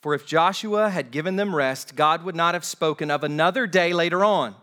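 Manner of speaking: projected, deliberate pulpit reading; slow scripture reading. Real, not acted. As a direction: read it out slowly to the whole church. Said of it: "I want to read these slowly".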